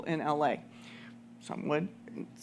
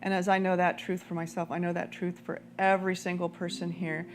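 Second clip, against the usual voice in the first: guided prayer; 2 semitones lower, 4 dB quieter than usual. Acted. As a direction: guide the room into prayer, unhurried. Leads prayer over music.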